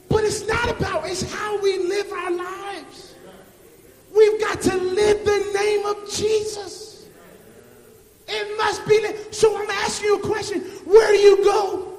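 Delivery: loud sermon climax, sung toward the end; full force preaching. Acted. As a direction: drive it home to the congregation.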